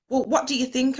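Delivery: fish for sympathy, half-joking; pushy mock demand. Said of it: "I'd be going".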